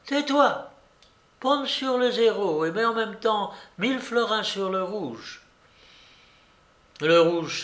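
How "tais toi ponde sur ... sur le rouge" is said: put-on high-pitched bossy old lady; imperious babouchka. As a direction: cut them off and give orders in a high voice. (vieille dame autoritaire, voix aiguë)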